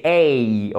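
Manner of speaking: A drawn out as in oseille; diphthong demonstration. Shown as a demonstration. Teacher explains the two symbols, long gliding A. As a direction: glide slowly through A, like "ey". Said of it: "c'est le son comme dans oseille".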